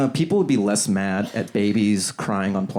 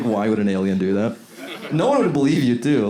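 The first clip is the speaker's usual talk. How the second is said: ordinary talk, nothing marked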